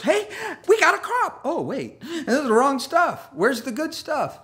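high, cheery mock voice; the weeds celebrating, then confused; voicing the weeds. Thistles cheering their crop, high mock voice. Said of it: "the thorns and thistles".

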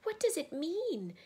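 high, loud, bewildered cry. A narrator's reading. Susan cannot understand the empty table, high loud cry. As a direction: throw the question up high. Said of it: "cried Susan".

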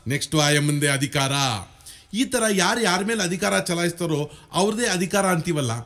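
ordinary delivery

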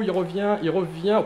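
usual speaking voice

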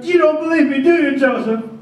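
loud, high anguished cry; plead, wounded; cried out. Acted. Mary crying out to doubting Joseph, anguished. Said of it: "To which she cried".

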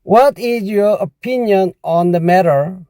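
very slow, careful English read; slow model sentence. Shown as a demonstration. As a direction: spell it out for the learner. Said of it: "이렇게 해야 됩니다".

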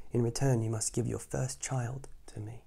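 hissed witch demand; sinister whisper. Acted. Witch whispering her price to the husband. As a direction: whisper it menacingly. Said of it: "the witch said".

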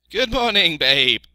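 loud, high sheep voice; another greeting sheep. A narrator's reading. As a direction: bleat a loud good morning.

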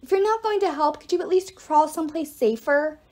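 high-pitched, exasperated call; yelled. A narrator's reading. Meg telling Apollo to crawl away, high impatient call. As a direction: scold urgently mid-fight. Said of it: "yelled Meg".

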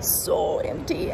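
slow, breathy whisper; slow awed whisper. Real, not acted. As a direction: hush it like an empty hall. Empty airport at night.

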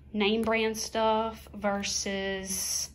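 usual speaking voice

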